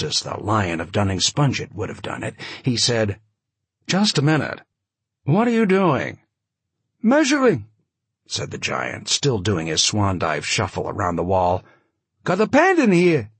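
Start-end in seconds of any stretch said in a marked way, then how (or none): 3.9-6.2 cool, higher, commanding; frosty commanding. A narrator's reading. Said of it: "frosty and commanding a tone as he could create". 7.0-7.7 much higher, loud insistent voice; high-pitched giant. A narrator's reading. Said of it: "oddly high-pitched voice". 12.2-13.3 put-on high, loud voice, light Yiddish accent; slight Yiddish accent. A narrator's reading. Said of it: "slightly Yiddish accent".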